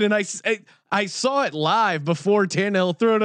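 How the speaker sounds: ordinary talk, nothing marked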